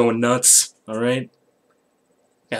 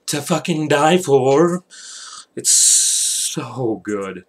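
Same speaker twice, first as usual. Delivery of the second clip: loud, slow gush over the cake; raving; 3 semitones higher, 5 dB louder, much slower than usual. Real, not acted. Raving to viewers about his friend's carrot cake, loud and slow. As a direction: stretch it out like it's heaven.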